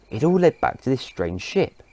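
ordinary delivery

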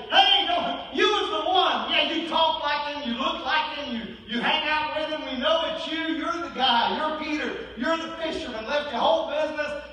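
loud, high-pitched finger-pointing; accusing callout. Acted. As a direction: call him out excitedly, piling up accusations. Accusers confronting Peter by fire.

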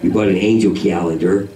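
low, laid-back LA speak; LA talk. Retold or imitated. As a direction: imitate a mellow Los Angeles local. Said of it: "That's how they talk out here in L A".

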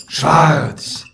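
drawn-out mock guard cry; towers' call. A narrator's reading. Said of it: "riefen auch nicht mehr ständig".

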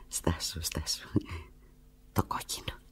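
low, soft whisper. Acted. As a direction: hush as if picturing him. Whispers imagining him.

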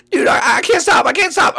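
a frantic little bird who can't stop; panicked high bird voice. Acted. Bird panicking at its rescuers, squeaky and frantic.

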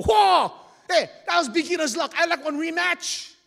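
loud, high, cocky rematch demand; arrogant loud demand. Acted. Sore loser demands a rematch from the wahine, loud and cocky. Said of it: "he's so arrogant".

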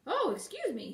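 high, loud swooping apology; jumping-back, startled exclamation. A narrator's reading. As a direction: apologize in flustered surprise. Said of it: "he replied, hastily drawing back".

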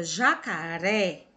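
"jacaré" with the last syllable "ré" punched; stressed final syllable. Shown as a demonstration. Teacher explaining the accent to pupils, "jacaré" stressed on final "ré". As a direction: lean hard on the "ré" ending "jacaré". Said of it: "essa sílaba fica mais forte".

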